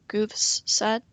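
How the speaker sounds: plain reading voice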